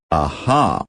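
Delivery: ordinary delivery, nothing marked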